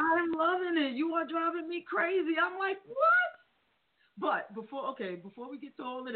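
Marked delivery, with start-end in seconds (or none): 0.0-3.0 high, thrilled raving; high-pitched excited fan praise; excited rave. Real, not acted. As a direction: gush like a fan who can't believe it. Host raving about the singer.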